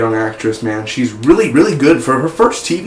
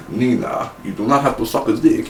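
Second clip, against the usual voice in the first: mock Russian accent; 5 dB quieter than usual. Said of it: "almost like a Russian voice".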